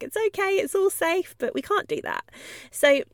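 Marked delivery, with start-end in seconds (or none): 0.0-1.3 much higher, gentle soothing coo; soothing reassurance. Pretend. Owner comforting a dog wrapped in cotton wool, high soothing coo. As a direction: coo it to a frightened pet. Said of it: "be like".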